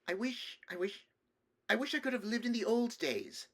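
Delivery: put-on high, hesitant child voice; small boy working up to his wish; wistful little boy. Nephew telling his uncle of the old days, wistful little boy.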